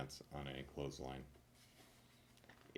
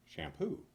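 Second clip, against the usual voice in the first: sneeze pun; 13 semitones higher than usual. Pretend. Sneezed punchline, champ-shampoo.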